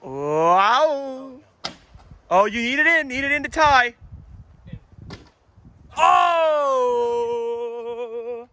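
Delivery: drawn-out exclamations at a near thing; real excitement. Real, not acted. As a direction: cry out as the game hangs on it. Announcer reacts to the deciding shots.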